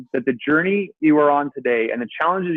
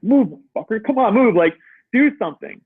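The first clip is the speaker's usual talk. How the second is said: higher, pressing mock command to his own toes; willing his toe to move, urgently; retold urgent command; 7 semitones higher than usual.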